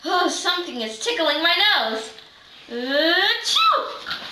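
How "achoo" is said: a big fake sneeze. Tickled nose, sneeze.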